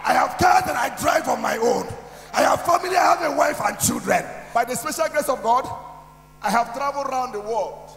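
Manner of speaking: preaching at full force. Real, not acted.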